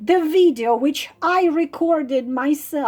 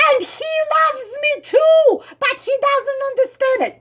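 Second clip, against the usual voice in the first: high, fast, whiny mimic of her; mocking lovesick writer; 7 semitones higher, faster than usual. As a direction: mimic her naive devotion.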